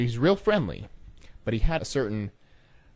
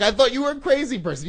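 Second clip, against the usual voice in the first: high, loud, rushed protest; exasperated outburst; 7 dB louder, faster than usual. Retold or imitated. Pranked rep protests to the prankster, high and loud. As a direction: blurt it out high and fast.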